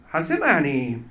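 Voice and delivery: ordinary talk, nothing marked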